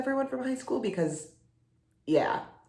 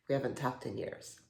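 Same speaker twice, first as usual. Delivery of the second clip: lower, much softer; subdued admission; 5 semitones lower, 9 dB quieter than usual. Acted. Admitting to an old friend how long it's been, subdued. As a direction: let it sink to a quiet, awkward admission. Same words, awkwardly trailing down.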